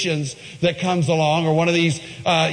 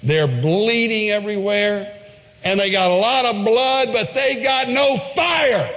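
rising preaching shout; 8 semitones higher than usual. Real, not acted. Building to a climax.

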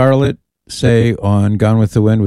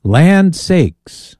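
a hammed-up Southern "Land sakes"; southern accent; 8 semitones higher than usual. Host exclaiming old southern phrase, southern accent. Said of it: "Why is your voice getting southern sounding".

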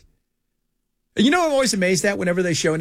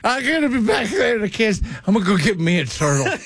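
mock hillbilly dad; 3 semitones higher than usual. Repeats Ken's line, mocking.

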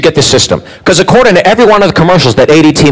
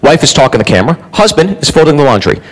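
ordinary talk, nothing marked

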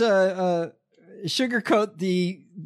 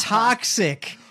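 put-on amateur Australian accent, slightly raised pitch; bad Australian accent; 4 semitones higher than usual. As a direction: attempt an Australian accent, badly. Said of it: "In my shitty Australian accent".

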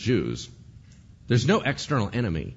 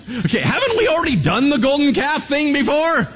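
laughing, higher and louder; 6 dB louder than usual. Real, not acted. Preacher laughs at history repeating itself, loud and amused. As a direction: chuckle through the question in disbelief.

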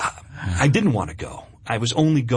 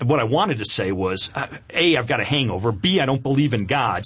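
ordinary delivery

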